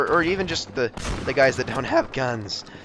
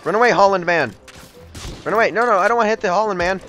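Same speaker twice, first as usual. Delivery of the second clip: shouted warning, pitched up; urgent calling out; 6 semitones higher, 6 dB louder, faster than usual. Pretend. Player warns the old man in the game to run, urgent calling out. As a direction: call out loudly, higher, to a man in danger.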